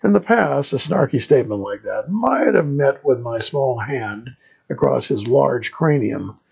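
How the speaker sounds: usual speaking voice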